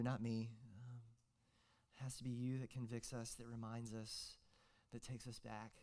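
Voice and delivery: hushed pastoral prayer; quiet prayer. Real, not acted. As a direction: pray gently to the congregation.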